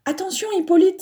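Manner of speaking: high warning call; a girl warning a friend at darts; warning cry. A narrator's reading. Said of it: "s'écrie Eva".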